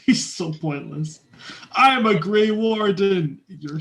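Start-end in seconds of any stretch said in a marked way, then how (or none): 1.7-3.4 mock-heroic proclamation, high and loud; drawn-out, booming parody of a proud warrior; Blackwall. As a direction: declare it with slow, pompous grandeur. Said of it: "the party member that nobody uses".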